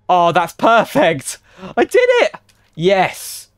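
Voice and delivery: loud, elated, jumping very high; triumphant cheer. Real, not acted. Player finally lining up the train doors, loud triumphant cheer. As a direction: celebrate it, voice leaping up on the win.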